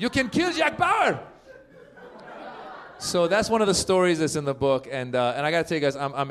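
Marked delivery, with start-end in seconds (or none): 0.0-1.2 high, slow, shouted mother's voice; mom's voice. Retold or imitated. Mom cheering her son on, high slow shout. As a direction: belt it out like an excited immigrant mom. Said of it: "She's like".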